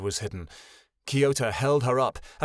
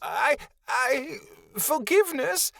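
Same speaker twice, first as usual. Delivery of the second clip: much higher, halting frightened whimper; 12 semitones higher than usual. A narrator's reading. Malachi whimpering before the Great One. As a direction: whimper in confusion and fear. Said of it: "Malachi whimpered".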